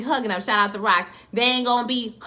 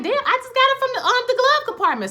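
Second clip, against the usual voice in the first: much higher-pitched mock protest; defensive retort; 14 semitones higher, faster than usual. Daughter explaining where she got it, defensive. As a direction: squeal back as if wrongly accused. Acted defensive answer to questioning.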